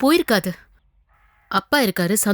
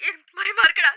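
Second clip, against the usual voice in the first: crying on the phone. Acted. Wife phones, crying in fear.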